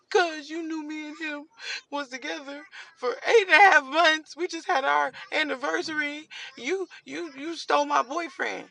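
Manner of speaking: high, teary, breaking voice; imitating a tearful cousin; crying accusation. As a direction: voice the cousin sobbing out her hurt.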